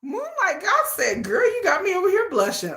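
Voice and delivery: high-pitched, giddy embarrassment; flustered gushing. Real, not acted. Telling viewers she's been made to blush, flustered and giddy. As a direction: gush in a high voice, playfully flustered.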